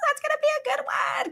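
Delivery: very high, quick squeal; high-pitched excited aside; gleeful anticipation. Real, not acted. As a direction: squeal it with delight, high and fast. Delight at next week's title.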